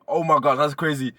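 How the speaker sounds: high, loud burst of real excitement; genuinely thrilled exclamation; excited outburst. Real, not acted. Spotting Dave on the next track, loud excited cry.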